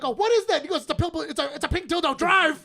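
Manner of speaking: high, loud panicked shouting; mock alarmed yell. Retelling the show, imitating the husband's horrified yell. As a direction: yell it in horror. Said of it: "Where he's like".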